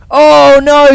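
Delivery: sudden high gasp of dismay; shocked commentator cry. Real, not acted. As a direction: react as the rider falls. Crash during a live run.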